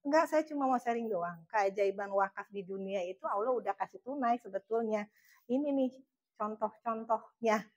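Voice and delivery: polite version for a client; polite saya version. Retold or imitated. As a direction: say it politely to a prospect. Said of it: "Saya ngomongnya ke gua lu ya sama temennya".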